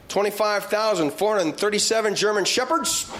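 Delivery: ordinary delivery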